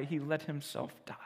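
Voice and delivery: a storyteller whispering the moment of death; whispered. A narrator's reading. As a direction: breathe the words out. Narrating Jesus' death.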